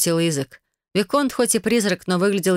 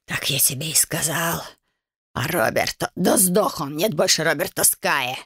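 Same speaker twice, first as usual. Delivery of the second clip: a beaten man rasping defiance; wheezing rasp. A narrator's reading. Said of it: "засипел мужчина". (сипло и злобно)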